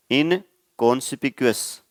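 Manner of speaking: "इनकॉन्स्पिक्यूअस" said slowly, every syllable clear; careful pronunciation. Shown as a demonstration. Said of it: "दो तीन part में आप divide कर लेना".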